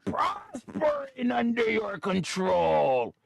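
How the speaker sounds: high, slow, grovelling tycoon voice; bow and scrape to the boss; fawning oil executive. Pretend.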